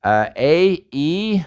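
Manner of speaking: A and E each gliding into a second vowel; English diphthong vowels. Shown as a demonstration. Teacher showing students English diphthongs. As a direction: let every vowel slide. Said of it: "even E in English is a diphthong".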